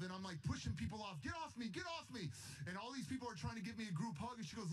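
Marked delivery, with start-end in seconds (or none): 1.2-2.3 retold protest. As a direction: act out fending people off, high-voiced. Pushing group huggers away.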